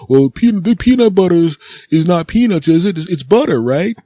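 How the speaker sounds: high, put-on clueless voice; clueless daycare worker. Daycare worker excusing the peanut butter to a parent, clueless. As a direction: play a dim worker fumbling for an excuse.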